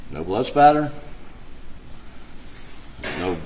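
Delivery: ordinary talk, nothing marked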